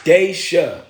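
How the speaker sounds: "Daisha" said as DAY-sha; checking the pronunciation. Shown as a demonstration. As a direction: sound out "Daisha", first syllable like "day". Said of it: "Is it Dasha or Daisha?".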